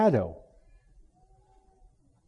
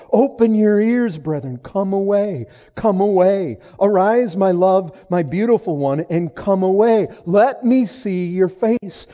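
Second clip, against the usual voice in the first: urgent appeal; 4 semitones higher, 6 dB louder than usual. Acted. Urges congregation with the beloved's call.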